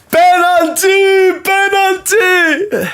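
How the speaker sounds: long, drawn-out high yell of penalty; calling for a penalty. Real, not acted. Fan yelling at the referee through the screen, long high drawn-out shout. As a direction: stretch each word out, calling for the ref's decision.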